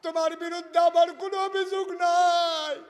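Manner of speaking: high, full-force declaration; impassioned shout. Acted. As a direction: shout it out to the wife, stretching the end. Ali's impassioned declaration, preached. (উঁচু গলায় আবেগে চিৎকার)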